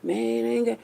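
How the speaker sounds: imitating the guy. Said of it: "You like".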